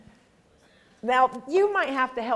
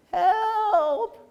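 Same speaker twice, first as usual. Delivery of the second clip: high strained cry of help; a weak fish groaning for help; feeble groan for help; 10 semitones higher than usual. A narrator's reading. Fish groaning feebly, calling for help. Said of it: "he groaned free-, feebly".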